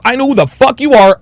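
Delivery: ordinary talk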